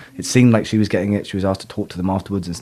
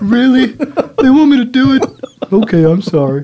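high, loud disbelief turning sheepish; squeaky put-on astonishment; mock surprised backtrack; 6 dB louder than usual. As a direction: play up a sudden change of heart. Acts sudden change of heart.

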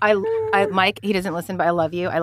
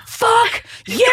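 retold shout; 12 semitones higher than usual. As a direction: belt it out like slamming the door on him. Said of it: "and I go".

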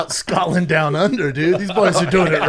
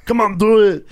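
higher, louder action-hero impression; Arnold Schwarzenegger; 6 semitones higher, 6 dB louder than usual. Mixing up movie quotes with friends, Arnold impression. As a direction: urge it loudly in an Austrian accent. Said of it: "That's Arnold Schwarzenegger".

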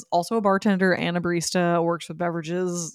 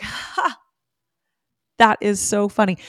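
amused laugh; 3 semitones higher, 6 dB louder, slower than usual. Retold or imitated. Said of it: "I literally just laughed".

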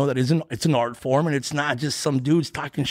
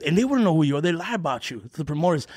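ordinary talk

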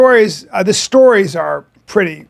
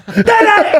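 high, laughing fight cry; mock karate shout; 14 semitones higher than usual. Acting out the elbow strike, a laughing high fight cry. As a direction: yelp it like a strike landing. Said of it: "he literally goes".

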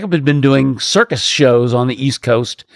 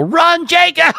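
high, urgent warning yell; retold yell; slower than usual. Storyteller yelling to a friend as a tree cracks, retold yell. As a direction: shout it high, as if for someone to flee. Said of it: "and I yell".

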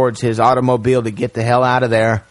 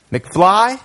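rising, curious call of the name; stranger calling out; 8 semitones higher than usual. Retold or imitated. Mysterious stranger calls Marty.

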